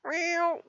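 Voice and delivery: a high cat meow; mock meow. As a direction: squeak a cartoon meow for the kitty. Showing a viewer's cat.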